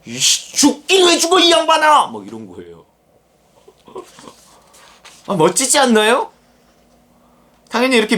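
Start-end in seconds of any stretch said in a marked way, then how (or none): 0.1-2.1 high, loud exasperated yell grabbing his collar; angry shout. Pretend. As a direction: shout it at him like a furious friend. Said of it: "소리를 지른 게 아닌가 싶". (멱살 잡고 외치는 베드로, 높고 큰 고함)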